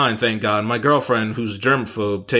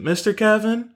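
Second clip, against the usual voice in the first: put-on child's voice; 10 semitones higher than usual. Child calls from back seat.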